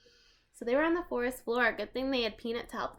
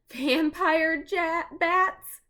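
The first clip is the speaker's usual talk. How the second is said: high, slow, louder echoed question; alarmed; 8 semitones higher, 8 dB louder, much slower than usual. Acted. Annie echoes Jack in fright, high and slow. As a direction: stretch it out in fright.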